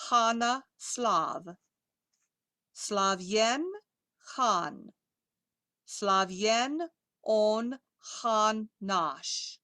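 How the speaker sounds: deliberate teaching pace with gaps between words; slow read-through. Shown as a demonstration. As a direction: read slowly, one Russian word at a time. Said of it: "read it slowly".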